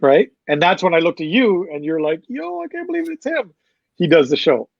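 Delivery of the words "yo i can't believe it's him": high, soft voice imitating a stunned friend; mock amazement. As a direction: squeak it softly in retold disbelief. Retelling a friend's amazed reaction.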